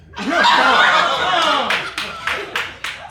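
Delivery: roaring with laughter; can't stop laughing; loud laughter fit. Real, not acted. Reader cracks up.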